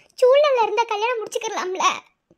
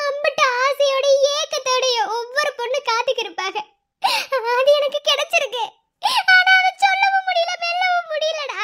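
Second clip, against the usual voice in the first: laughing at her own misery; bitter laugh through tears; 4 semitones higher than usual. Acted. (கண்ணீருடன் கசப்பான சிரிப்பு)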